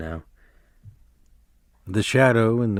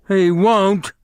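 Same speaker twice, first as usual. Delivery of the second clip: much higher, louder panicked denial; frantic cry; 10 semitones higher, 6 dB louder than usual. A narrator's reading. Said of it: "Lenny cried frantically".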